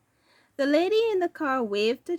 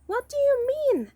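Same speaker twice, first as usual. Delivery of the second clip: high, surprised and a bit louder; surprised question; 13 semitones higher, 4 dB louder than usual. Acted. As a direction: blurt it out in surprise. Kenny asks in surprise.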